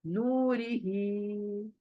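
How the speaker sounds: नूरिही with नू and ही drawn out; long vowels. Shown as a demonstration. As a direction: hold the नू and the ही of नूरिही, slowly. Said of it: "ही खड़ी जेर की वजह से". (नूरिही में नू और ही को खींचकर)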